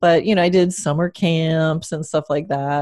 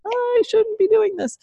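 high-pitched panicky. Retold or imitated. Retold panic on runaway horse.

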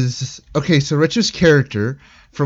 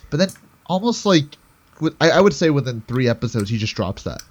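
ordinary talk, nothing marked

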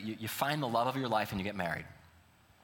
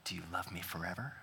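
soft whispered question; 6 semitones lower, 6 dB quieter than usual. Acted. Groom whispering to spouse at the reception, softly. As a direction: lean in and whisper it. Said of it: "And so then you whisper".

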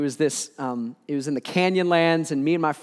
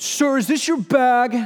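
higher, louder stern officer ask; officer questioning; 8 semitones higher, 4 dB louder, slower than usual. Retold or imitated. Guard holding the bag asks the speaker, stern. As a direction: voice the guard checking him.

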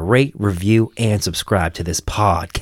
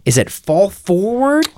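high unsure question; 10 semitones higher, slower than usual. Real, not acted. Unsure question about clock change.

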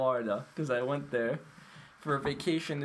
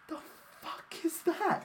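mock outrage; 12 semitones higher, slower than usual. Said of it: "He goes".

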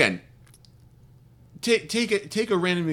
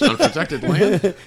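slowed-down question through laughter; laughing; 4 semitones higher, 5 dB louder, slower than usual. Real, not acted. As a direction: laugh while asking. Laughing repeat of quip.

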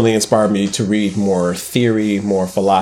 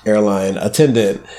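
ordinary talk

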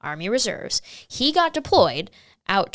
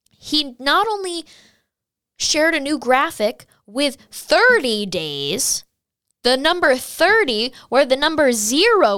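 higher, louder, building indignation; outraged rant; 6 semitones higher, 5 dB louder than usual. Real, not acted. Venting her outrage at the comms team to viewers. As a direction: rant with rising exasperation.

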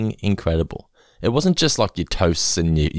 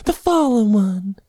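mock grand title; 12 semitones higher, slower than usual.

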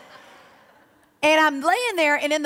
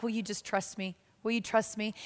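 much softer, gentle plea; quiet voice of the Lord; 2 semitones lower, 8 dB quieter than usual. Acted. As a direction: ask it softly, tenderly. The Lord's quiet question.

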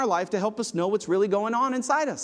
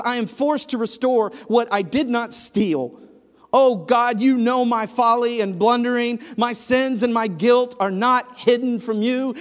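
louder, pleading scripture read; impassioned psalm reading; 5 semitones higher, 5 dB louder than usual. Acted. Preacher reads King David's cry for rescue, pleading and loud. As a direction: read it as a drowning man's plea.

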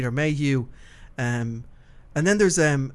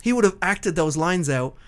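ordinary talk

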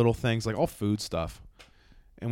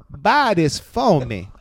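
BUY THIS FOR ME drawn out very slowly, louder and higher; worded out slowly; 6 semitones higher, 8 dB louder, much slower than usual. Retold or imitated. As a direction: spell out "Buy this for me" word by word in her accent. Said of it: "she words it to me".

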